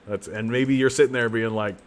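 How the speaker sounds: ordinary talk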